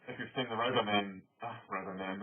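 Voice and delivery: normal talking voice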